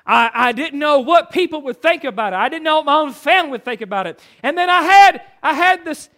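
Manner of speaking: loud, ringing pulpit delivery, leaning hard on key words; full-force preaching. Acted. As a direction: preach it out to the whole congregation.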